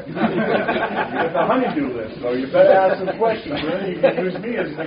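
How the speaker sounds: loud, laughing jab about chores; laughing tease. Real, not acted. Teasing the men about their honeydew list, laughing. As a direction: rib them with a laugh.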